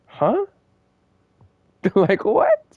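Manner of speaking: high, disbelieving huh; stunned huh. Retold or imitated. Student stunned by the teacher's reply, high disbelieving huh. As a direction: react like you can't believe what you heard.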